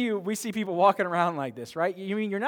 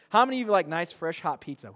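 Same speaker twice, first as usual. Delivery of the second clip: ordinary talk, nothing marked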